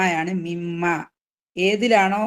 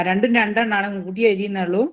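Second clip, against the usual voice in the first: ordinary delivery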